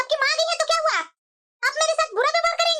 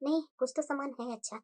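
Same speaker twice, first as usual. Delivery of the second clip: much lower, much softer private mutter; soft muttering to self; 13 semitones lower, 13 dB quieter than usual. Acted. Mother-in-law secretly sorting gifts for her daughter, soft mutter. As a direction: murmur it so nobody hears.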